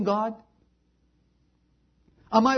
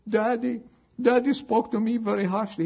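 put-on high child voice telling on daddy; high-pitched, lightly acted young girl; upset little girl; 7 semitones higher than usual. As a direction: imitate a hurt daughter complaining. Hurt little girl complains to mother.

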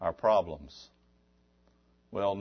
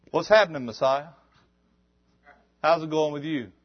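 casual greeting; 5 semitones higher, 4 dB louder than usual. Retold or imitated. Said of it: "He didn't come up and say".

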